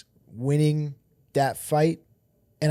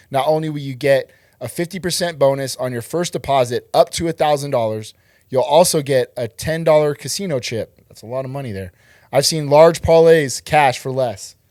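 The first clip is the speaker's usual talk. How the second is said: upbeat ad read, punchy and louder; sponsor ad read; 5 dB louder than usual. Acted. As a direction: sell it like a radio spot.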